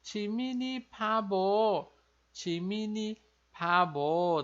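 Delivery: "timini pabo" bem devagar, sílaba por sílaba; pronúncia coreana. Retold or imitated. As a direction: diga "timini pabo" lentamente, imitando o Vi. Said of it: "o Vi tá falando". ("timini pabo" lento, provocando de leve)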